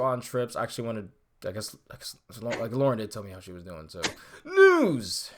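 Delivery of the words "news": big announcer call; announcer shout. Acted. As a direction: belt it like a segment intro. Announcing the news segment.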